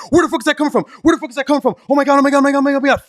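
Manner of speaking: high, loud, panicked replay of the moment; freaking out. Retold or imitated. DJ panicking as the wrong song blasts during the first dance, high and loud. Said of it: "freaking the fuck out".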